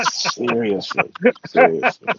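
giggling through the word; high laughing repeat. Real, not acted. Laughing after joke.

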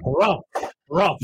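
high pompous harrumph; mock harrumph. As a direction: clear the throat like a pompous official.

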